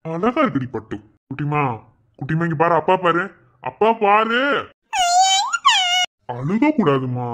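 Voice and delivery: low, soothing parent voice; low-pitched pet-name cooing; tender coaxing. Acted. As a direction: coax a tearful child gently. Father coaxes crying child.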